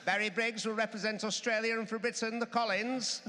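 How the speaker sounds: put-on high, old newsreel stadium announcer; 1950s speedway announcer. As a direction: proclaim it like a vintage stadium PA. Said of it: "in a nineteen fifties, uh, Speedway announcer style".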